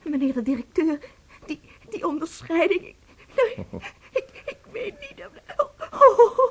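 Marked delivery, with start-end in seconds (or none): 1.5-6.4 high sobbing, broken words; tearful stammering thanks; crying with happiness. Acted. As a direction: weep with overwhelmed joy. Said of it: "U huilt van geluk".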